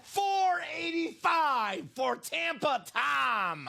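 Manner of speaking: high, slow, stretched-out shout; excited hype call. Real, not acted. Host hypes a buyer's bargain, excited drawn-out call. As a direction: belt out the price like a ring announcer. Hyping the winning price.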